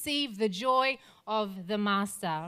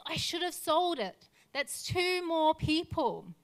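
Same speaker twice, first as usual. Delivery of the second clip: high, strained, slow cry of regret; weeping regret; 5 semitones higher than usual. Retold or imitated. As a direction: voice him breaking down over what he could have saved. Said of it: "and he says".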